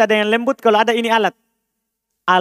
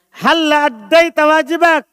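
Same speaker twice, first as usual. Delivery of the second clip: higher, pushy demanding tone; forceful demand; 7 semitones higher, 4 dB louder than usual. Shown as a demonstration. Teacher acting a stern demand to a student, pushy. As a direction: press them hard to do their duty. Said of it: "Dia bilang nadanya".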